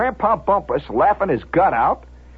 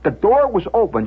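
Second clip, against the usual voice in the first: ordinary delivery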